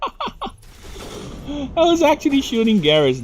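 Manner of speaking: slow, high, laughing through the words; chuckling it out in disbelief. Real, not acted. Streamer laughing about shooting Garrus, slow and amused. Laughs at his own mistake.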